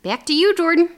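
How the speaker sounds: put-on loud, high Midwestern accent. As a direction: toss it over in a Midwestern accent. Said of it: "It's my Midwestern accent".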